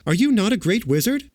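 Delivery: put-on high, incredulous girl's cry; astonished question. A narrator's reading. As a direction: ask it high, in disbelief. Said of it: "cried Dorothy".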